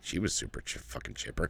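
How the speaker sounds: put-on whispered, deadpan teen aside; mock whisper. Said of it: "She's like".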